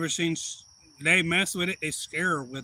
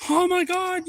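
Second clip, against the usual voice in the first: high, loud mock squeal of delight; acted burst of high-pitched excitement; super excited kids; 7 dB louder, slower than usual. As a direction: imitate thrilled kids shrieking. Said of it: "all super excited".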